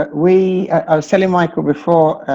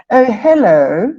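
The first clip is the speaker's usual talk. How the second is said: imitating a posh toddler, higher and slow; posh little girl; 6 semitones higher, 4 dB louder, slower than usual. Father imitating his posh toddler daughter. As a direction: put on a posh child voice. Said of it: "very posh".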